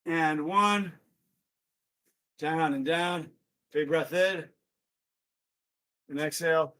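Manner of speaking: soft breathing cues; calming. Real, not acted. Instructor leads the class through recovery breaths, calming. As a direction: ease them down quietly, breath by breath.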